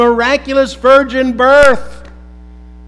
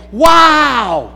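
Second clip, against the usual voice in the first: loud, higher amazed Wow!; exclaimed wow; 4 semitones higher, 5 dB louder than usual. Shown as a demonstration. Preacher showing what behold means, amazed. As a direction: exclaim Wow! like you just saw something. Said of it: "It's kind of like saying".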